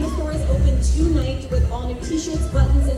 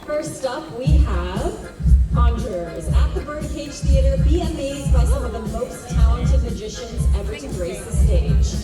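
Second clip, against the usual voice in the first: announcer read. Acted. Announcing the shows.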